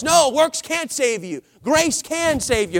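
normal talking voice

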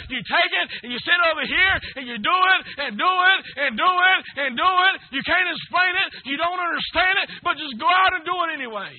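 rising sermon excitement; building fervor. Acted. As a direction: build it up each repeat. Rising preaching climax.

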